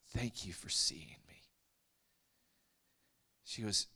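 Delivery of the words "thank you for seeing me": tearful whisper. Retold or imitated. A broken girl thanking the preacher, in a tearful whisper. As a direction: breathe it out slowly through tears. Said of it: "through her tears".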